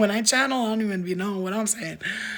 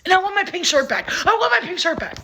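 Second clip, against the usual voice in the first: put-on high, mean-girl quote voice; movie quote voice; 9 semitones higher than usual. Quoting the movie to her viewers, mean-girl voice. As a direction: sneer it like the movie line.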